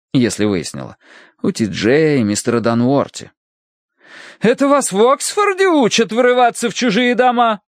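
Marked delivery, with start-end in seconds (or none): 4.4-7.6 high-pitched indignant matron. A narrator's reading. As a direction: scold intruders in a high, outraged voice. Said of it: "возмущалась миссис Меринг". (возмущённо, высоким голосом)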